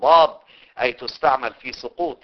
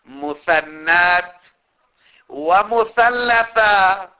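slow dictation; 7 semitones higher, 8 dB louder, much slower than usual. Shown as a demonstration. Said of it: "بالتاء المشددة".

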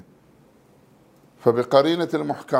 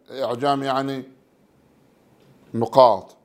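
normal talking voice

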